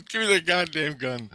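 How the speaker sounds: high-pitched exasperated order; acted heated command; mock angry demand. Housemate demands the gun, acted.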